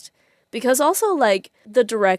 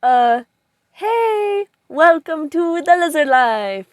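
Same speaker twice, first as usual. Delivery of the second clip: much higher and louder, bursting hello; excited greeting; 10 semitones higher, 9 dB louder, slower than usual. Real, not acted. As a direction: greet the viewers with a big burst of energy. Opening greeting to viewers.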